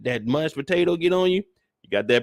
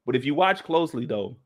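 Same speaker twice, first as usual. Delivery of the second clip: normal talking voice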